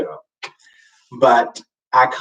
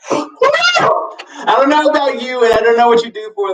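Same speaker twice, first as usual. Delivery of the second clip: mock crying wail; 10 semitones higher, 4 dB louder than usual. Vlogger to viewers, a high mock crying wail. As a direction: whine it like a mock sob. Dramatic wail about bad day.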